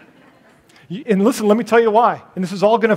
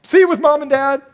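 much higher, louder sing-song goodbye; cheery send-off; 10 semitones higher, 4 dB louder than usual. Retold or imitated. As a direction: shoo the grandkids home cheerfully. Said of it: "and then go".

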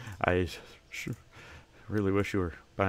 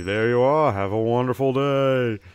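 cheery shopkeeper; 3 semitones higher, 7 dB louder than usual. Acted. Shopkeeper hailing a customer entering the store, cheery and loud. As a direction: welcome customers like an eager shopkeeper.

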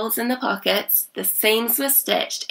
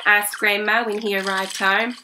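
plain reading voice

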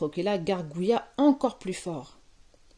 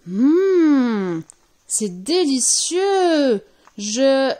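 very loud, high, slow relish; huge ecstatic yum; savouring delight; 5 semitones higher, 8 dB louder, much slower than usual. A narrator's reading. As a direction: stretch every sound in swelling rapture. Boy savours soup before transforming.

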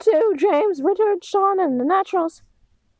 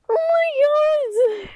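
crying shout; 9 semitones higher, much slower than usual. Acted. Horror at the zapping.

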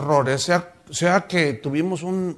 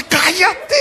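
high, loud hissing rebuke with a laugh; mock a hypocrite silencing his wife; a hammed-up furious husband; angry hush; 13 semitones higher, 6 dB louder than usual. Husband again.

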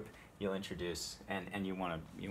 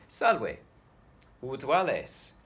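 acted greeting; 7 semitones higher, 9 dB louder, much slower than usual. Shown as a demonstration. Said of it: "we could say".

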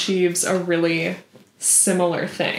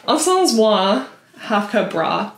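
"Ensemble Moi" said in a self-mocking French accent, nasal "en", "moi" as mwa; shitty French accent. Shown as a demonstration. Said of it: "my shitty French accent".

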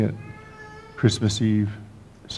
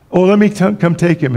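high, louder hurried call; mock eager rescue; 7 semitones higher, 6 dB louder than usual. Wife hurrying to take the baby back. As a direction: call out like you're swooping in to save the baby.

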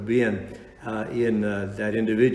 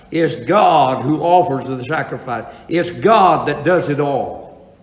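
preaching at full force; 3 semitones higher, 5 dB louder than usual. Acted. Preacher to congregation about justification, loud and slow. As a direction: hammer home God's work, slowly.

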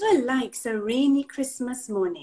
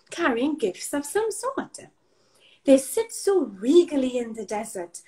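ordinary delivery, nothing marked

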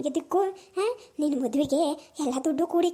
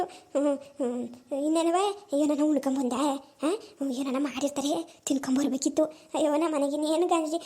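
put-on a frail old mother, curious then self-pitying; 3 semitones lower than usual. Old mother asks daughter about granddaughter and food, in a low old voice.

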